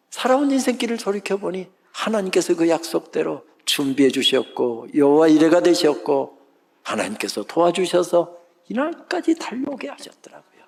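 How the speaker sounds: normal talking voice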